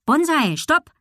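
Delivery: high, urgent call. A narrator's reading. Girl stops dog running off, calling out. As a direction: call the dog back sharply, pitched high. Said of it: "Deshalb rief sie".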